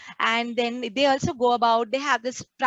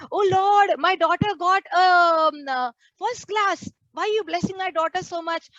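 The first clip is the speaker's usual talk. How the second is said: higher, lightly acted parent boasting to God; a touch of proud bewilderment; mock thankful prayer; 6 semitones higher than usual. Preacher imitates a parent praying about a daughter, mock puzzled. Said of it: "We never say".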